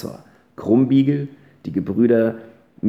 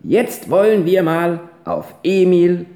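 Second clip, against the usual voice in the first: high, louder call across the room; 5 semitones higher, 4 dB louder than usual. Acted. Ponyhütchen calls to everyone with the jug, high and loud. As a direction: raise the voice to the whole table. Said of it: "hielt die große Kanne hoch und rief".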